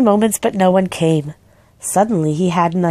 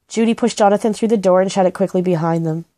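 ordinary delivery, nothing marked

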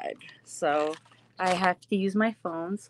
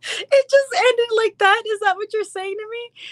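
high, loud question through laughter; real laughing; 11 semitones higher, 5 dB louder than usual. Real, not acted. Streamer reacting to viewer's Sopranos joke, laughing.

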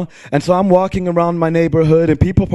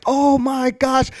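high, drawn-out frustrated cry; worked-up venting, pitched high; acted exasperation; 9 semitones higher, slower than usual. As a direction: stretch it out as if fed up. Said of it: "I'm going through all these things".

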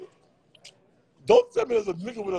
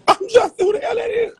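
laughing; 7 dB louder than usual. Real, not acted. Laughing at a rude commenter.

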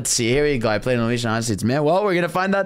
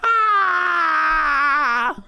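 pained cry; 12 semitones higher than usual. Real, not acted. Reacts to cutscene fall.

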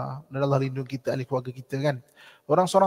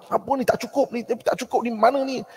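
higher, grumbling mock complaint at the food; grumpy complaint; 6 semitones higher than usual. A grumpy eater complaining at the table, mock irritated. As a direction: whine that the meal isn't enough.

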